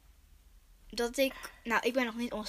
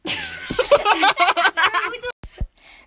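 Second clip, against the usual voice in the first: high loud giggling fit; loud giggle burst; 10 semitones higher, 10 dB louder than usual. Real, not acted. Burst of laughter.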